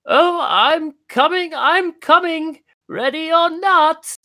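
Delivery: put-on high, slow old man singsong; an elderly mouse calling out in a game of hide and seek; old mouse playing seeker. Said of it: "he says".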